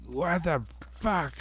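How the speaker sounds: high, sharp mock blow-up; retold outburst. Snapping at his daughter over breakfast, retold high outburst. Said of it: "I lost my shit on her".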